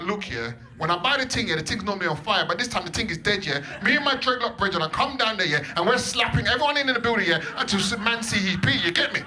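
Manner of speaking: loud, rising street-talk threat; street rant. Retold or imitated. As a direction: boast about the dreadlock brethren. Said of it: "I was like".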